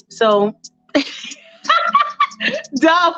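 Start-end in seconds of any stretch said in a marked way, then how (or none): 1.6-2.7 sudden high squeal of laughter. Real, not acted. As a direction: burst into a squeaky giggle. Speaker cracks up.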